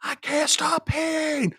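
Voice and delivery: squeaky cartoon voice, very high and loud, the last line drawn out slow; mock back and forth. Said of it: "It's like".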